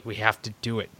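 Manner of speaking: low, quiet grim resolve; hushed dramatic insistence; previously on recap. Pretend. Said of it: "let me do my impression of Walking Dead".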